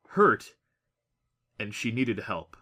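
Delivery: usual speaking voice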